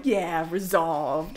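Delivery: slow, drawn-out mock self-satisfaction; imitating a smug little victory. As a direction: play pleased with yourself, taking your time. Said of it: "all smug with myself".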